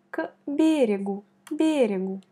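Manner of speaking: берегу with the accent on the first е; stress on берегу. Shown as a demonstration. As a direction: lean on бе in берегу. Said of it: "On met l'accent sur la première voyelle". (accent sur la première syllabe de берегу)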